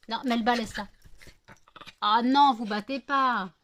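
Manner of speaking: sharp, loud call to stop a dog fight; scolding dogs. Real, not acted. Sépare les chiennes qui se battent. (ton de gronderie ferme pour séparer les chiennes)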